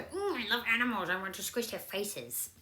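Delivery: a hint of a gushing pet-lover kitty; inner kitty cat voice. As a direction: purr it out as a cuddly little cat. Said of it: "my little kitty cat go".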